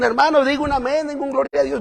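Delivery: a hint of a preacher coaxing amens; preacher prompting the crowd. Pastor mimicking a preacher urging the congregation, lightly high and quick. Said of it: "el predicador tiene que decir a la congregación".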